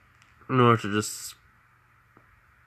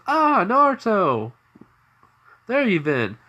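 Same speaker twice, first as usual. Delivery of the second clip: excited landlord; 11 semitones higher, 5 dB louder than usual. Acted. Landlord greets Naruto loudly.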